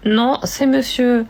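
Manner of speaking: loud, slow, insistent correction; acted caller's correction. Caller mistaken for a woman by the bank, loud slow correction. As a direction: correct the bank clerk loudly and slowly. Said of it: "qui est au téléphone avec sa banque".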